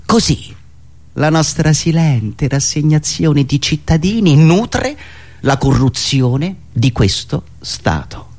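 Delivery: ordinary talk, nothing marked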